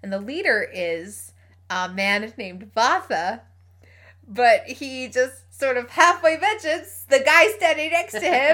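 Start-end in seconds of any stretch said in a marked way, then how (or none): 4.3-8.5 rising with excitement, higher and louder; excited build-up. Real, not acted. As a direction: build up to the big reveal. Building excitement before a reveal.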